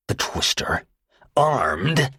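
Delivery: menacing but a touch of fear; a killer suddenly uneasy; malignant with fear. Acted. Tony realizing the bomb is armed, malignant with hidden fear. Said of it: "malignant as ever ... overtones of fear".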